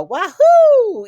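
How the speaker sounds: high, loud whooping cheer; joyful holler. Retold or imitated. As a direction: let out a long, gleeful "wahoo". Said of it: "He even let out".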